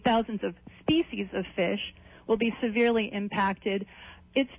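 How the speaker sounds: normal talking voice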